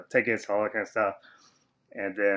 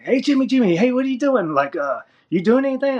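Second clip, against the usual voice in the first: high, loud mock urgent calling; frantic calling; 6 dB louder than usual. Crew members imitated rushing to find Jimmy, high and loud. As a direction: shout his name like people in a panic. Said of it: "come running to me, like".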